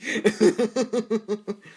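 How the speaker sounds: loud, high fit of real giggles; high laughter. Real, not acted. Child amused by a slowed-down clip, loud high laughter. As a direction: crack up helplessly.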